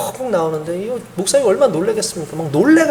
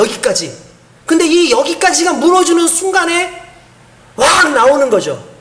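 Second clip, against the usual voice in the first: raised, urgent sermon voice; impassioned preaching; 6 semitones higher, 4 dB louder than usual. Acted. Pastor presses the congregation on rote faith, preaching hard. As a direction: drive the point home to the church.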